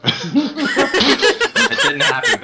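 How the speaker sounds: drawn-out laughing protest; laughing denial. Real, not acted. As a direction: stretch it out through laughter.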